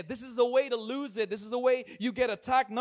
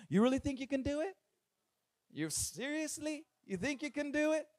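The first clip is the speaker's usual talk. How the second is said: higher, softer, sceptical questioning; doubting hater; 5 semitones higher, 5 dB quieter than usual. Acted. Haters doubting someone's dream, incredulous. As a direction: question them in disbelief, pitched up. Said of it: "the haters come".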